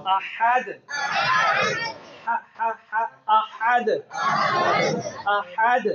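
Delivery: "अहद" with friction on the h and bouncing final d; throaty ha. Shown as a demonstration. Teacher drills the class on the verse ending, throaty ha. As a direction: squeeze the h of "अहद" in mid-throat, firmly. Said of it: "और ये है हा".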